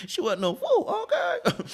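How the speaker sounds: tickled, high-pitched aside; amused repeat. Real, not acted. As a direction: say it through a chuckle, pitch climbing. Amused repeat of own remark.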